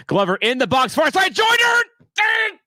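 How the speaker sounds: high-pitched, building play-by-play yell; excited match shout. Real, not acted. Streamer calling Joyner's chance in the box, excited shout. Live call of a near goal.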